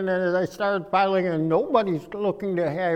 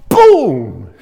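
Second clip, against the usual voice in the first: loud boom; 8 semitones lower, 9 dB louder than usual. Retold or imitated. Girl lands in seat.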